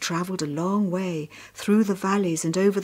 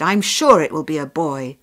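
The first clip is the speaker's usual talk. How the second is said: loudly sure and pleased; lightly voiced proud soldier; happy soldier; 2 semitones lower, 9 dB louder than usual. A narrator's reading. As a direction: insist on it with happy confidence. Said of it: "the soldier was very happy".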